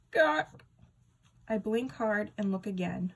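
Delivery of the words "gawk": high loud startled gawk squawk; comic shocked squawk. A narrator's reading.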